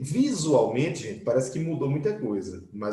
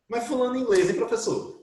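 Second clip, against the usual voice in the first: student asking; 8 semitones higher than usual. Retold or imitated. Teacher voices student's question.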